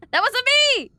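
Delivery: high, loud squealed denial; laughing protest. Real, not acted. Streamer denying to chat she was the lookalike, squealed protest. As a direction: protest it loudly, high-pitched, through amusement.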